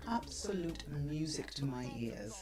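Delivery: breathy whispered purr. Acted. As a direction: breathe it like a secret. Whispered ad line.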